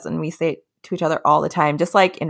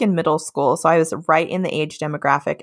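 ordinary talk, nothing marked

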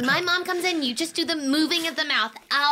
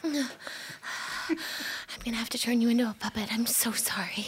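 hushed, breathless apology; whispering; 4 semitones lower, 7 dB quieter than usual. Acted. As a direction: whisper it so no one hears. Apologizing to the dead friend.

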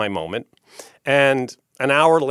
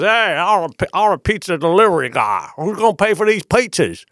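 high, hammed-up Australian with a Southern slip; attempted Australian accent; 8 semitones higher, 4 dB louder than usual. Drummer pretending to be pizza delivery, attempted Australian accent. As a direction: put on a bad, high-pitched Australian accent. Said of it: "I'm trying to do Australian".

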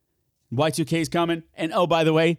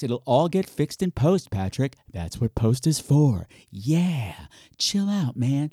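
calm self-talk; 3 semitones lower, slower than usual. Acted. Talking to himself, calming.

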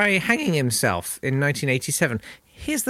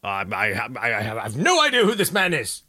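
the Duke; 3 semitones lower than usual. Retold or imitated.

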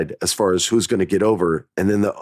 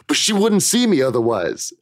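higher, mock blurted-out excuse; retold shout out; 6 semitones higher than usual. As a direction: blurt it out like a caught kid. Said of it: "I shout out".

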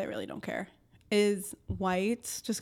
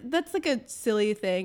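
ordinary talk

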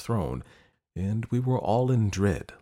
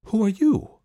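high, loud startled cry; startled exclaim; 12 semitones higher, 7 dB louder than usual. Acted. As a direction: jump back in fright. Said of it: "exclaimed his highness".

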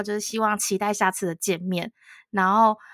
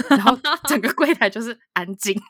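laughing; 4 dB louder than usual. Real, not acted. Laughing at awkward silence.

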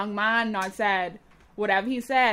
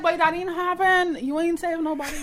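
mocking witness; 7 semitones higher than usual. Voicing the witness.

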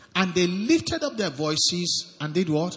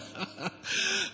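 a quick high laugh at his own point; short laugh; 12 semitones higher, 11 dB quieter than usual. Real, not acted. Preacher laughing to the congregation at the prayer, a quick high laugh. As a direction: laugh it off, amused.

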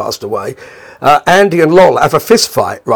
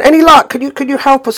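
very high, eager call; imitated pleading couple; 11 semitones higher than usual. Couple calling up to Lol at the window, high pleading. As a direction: mimic someone begging hopefully, voice pitched way up.

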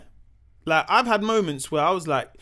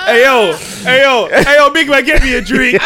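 caricature of friend; 6 semitones higher, 11 dB louder than usual. Acted. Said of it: "That voice is familiar".

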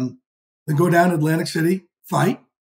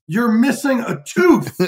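incredulous makeup artist; 7 semitones higher, 3 dB louder, slower than usual. Retold or imitated.

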